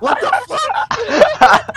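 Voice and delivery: shocked outburst. Real, not acted. Suddenly caught while hiding.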